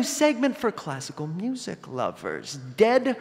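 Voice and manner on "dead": ordinary delivery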